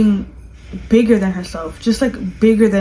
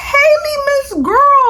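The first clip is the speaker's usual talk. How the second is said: shrieking delight; 4 dB louder than usual. Real, not acted. Reviewer squealing over the actress's line, high delighted shriek. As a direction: squeal her name way up high, laughing.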